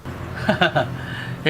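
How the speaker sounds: soft breathy scoffing laugh; husband sneers at the idea of going to his wife's feet; scornful laugh. Acted. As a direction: laugh it off with contempt. Husband scoffs at the elder's warning.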